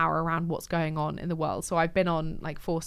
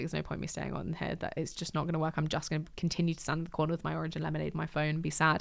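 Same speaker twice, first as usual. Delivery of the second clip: quiet; 8 dB quieter than usual. Retold or imitated. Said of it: "I was like".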